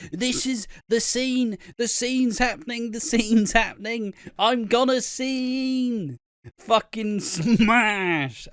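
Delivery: high-pitched mock frenzy, key words stretched out; hammed-up excitement. Viewer realising the flash forward scene is happening, hammed-up excitement. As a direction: build the giddy panic as it unfolds. Acting out reaction to big scene.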